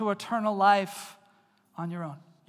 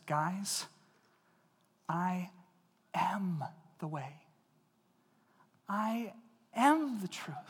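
soft, slow, stress on am; Jesus speaking gently to his friends; gentle invitation; 5 dB quieter, much slower than usual. Shown as a demonstration. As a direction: say it like a friend offering the way. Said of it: "I think he said this".

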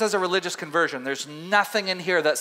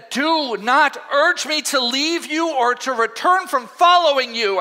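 slow, higher, earnest vow; Ruth's earnest plea; 6 semitones higher, 4 dB louder, slower than usual. Acted. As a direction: refuse to be sent away.

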